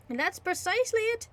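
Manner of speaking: high, quick innkeeper reply. Acted. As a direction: snap it back as landlord. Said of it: "said the landlord".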